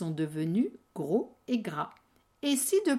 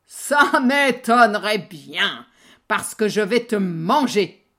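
troll voice; 10 dB louder than usual. Acted. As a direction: threaten like a troll. Troll threatens big goat.

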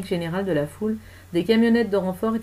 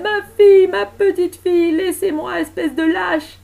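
high, loud desperate yell; frantic, furious screaming; 12 semitones higher, 11 dB louder, slower than usual. Acted. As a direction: howl like a mother torn from her child. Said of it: "hurlait-elle".

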